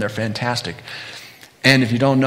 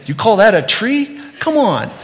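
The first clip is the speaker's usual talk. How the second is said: higher, louder playful disbelief; mock incredulous; 9 semitones higher, 6 dB louder than usual. Father jokingly scoffing at his son's tree drawing. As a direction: tease it like a fond critic.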